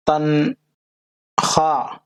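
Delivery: तनख्वाह drawn out slowly, silent वाव, said as tankhah; pronunciation demo. Shown as a demonstration. Teacher showing students a silent letter, slow demo. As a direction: spell out तनख्वाह slowly without the वाव sound. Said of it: "वाव ये silent है".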